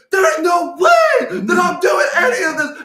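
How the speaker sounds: very high, drawn-out reenacted scream. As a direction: yell the monologue at full tilt, slowly and furiously. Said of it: "I was just screaming".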